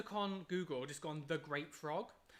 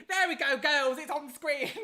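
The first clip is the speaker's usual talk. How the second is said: much higher and louder, bursting into a laugh; excited; 11 semitones higher, 12 dB louder than usual. Real, not acted. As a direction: celebrate it finally working, loud and bright. Super chat finally appears on screen.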